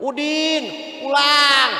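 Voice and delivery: loud yell across the yard; shouting at a child. Retold or imitated. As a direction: bellow the child's name home. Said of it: "kita teriak kenceng bener". (teriakan emak memanggil anak pulang)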